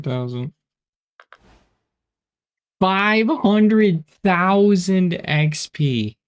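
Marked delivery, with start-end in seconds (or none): 2.8-6.2 excited. Real, not acted. Reading out huge XP gains.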